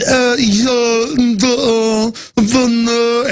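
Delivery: high, dopey mock-yuppie mumbling; weak, pathetic yuppie. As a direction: imitate someone barely conscious, in drawn-out high uhs. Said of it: "you act weak. The weaker and more pathetic".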